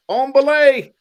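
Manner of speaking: belay call yelled up high and loud; mock climber's call. Buddy calls out to the outhouse, hammed-up high loud climber's shout. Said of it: "You go".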